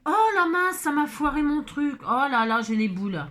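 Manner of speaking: loud, high cry of dismay, dropping off at the end; dismayed outcry. Real, not acted. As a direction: blurt it out as the craft gets ruined. Plastifieuse ruine son bricolage. (cri de dépit)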